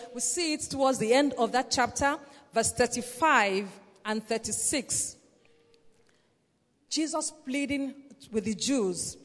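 ordinary talk, nothing marked

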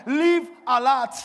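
loud, high, drawn-out exhortation; rousing charge. Shown as a demonstration. Preacher showing the congregation what to tell neighbours, loud rousing charge. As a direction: proclaim it slowly to the whole room. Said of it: "Tell them".

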